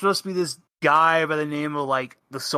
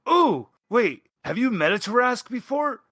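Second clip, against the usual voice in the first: higher, quick eager questions; excited question; 7 semitones higher, faster than usual. Acted. Fang gets excited about Tarrasques.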